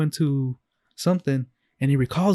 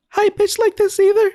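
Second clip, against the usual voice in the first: squeaky, much higher voice, a bit louder; very high-pitched demo; 4 dB louder than usual. Shown as a demonstration. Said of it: "it's not deep like this".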